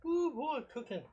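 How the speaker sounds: high surprised whoop; a sudden delighted ooh. Real, not acted. Surprised by the hot steak.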